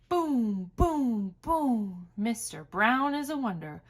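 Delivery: ordinary delivery, nothing marked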